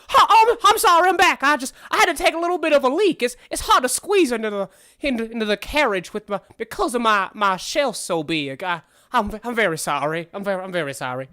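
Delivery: high, flustered, rushed start sinking into sheepish apology; Armand, apologetic. Acted. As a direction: burst in loudly, then wind down apologizing. Voices Armand, apologizing on return.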